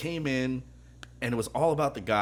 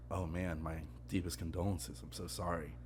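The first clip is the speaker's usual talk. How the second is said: low, soft, subdued sympathy; hushed condolence; 5 semitones lower, 11 dB quieter than usual. Retold or imitated. Waiter to bereaved customer, hushed and low. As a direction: offer condolences quietly, voice dropped right down.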